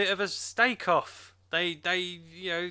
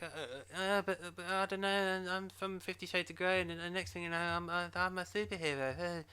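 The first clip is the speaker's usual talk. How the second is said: mock Cassie; 2 semitones higher, 7 dB quieter than usual. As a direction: stammer it out like a lost heroine. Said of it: "And Cassie's all like".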